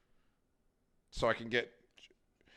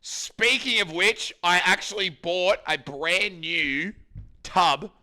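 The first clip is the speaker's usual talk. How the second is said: slow, higher, louder hype; ad read; 6 semitones higher, 7 dB louder, slower than usual. Pretend. Streamer announcing a new tub to chat, ad hype. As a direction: sell it like an advert. Hyped product reveal.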